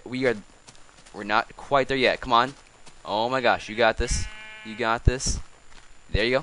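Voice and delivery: ordinary talk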